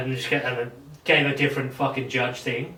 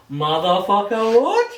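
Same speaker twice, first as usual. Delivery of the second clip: slow drawn-out curse, then a high squeak of disbelief; stunned, mock-outraged what; shocked outburst; 6 semitones higher, 6 dB louder, slower than usual. To self in court, stunned slow curse then high squeak. Retold own shocked reaction.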